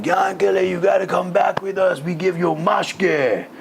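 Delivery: boisterous mock-drunk invitation; drunk Russian soldiers. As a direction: act the rowdy drinking pals luring him back. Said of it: "he's like Russian drunk soldiers".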